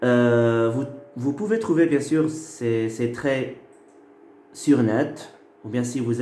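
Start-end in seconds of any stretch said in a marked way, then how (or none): none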